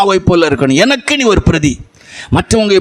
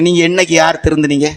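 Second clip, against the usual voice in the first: ordinary talk, nothing marked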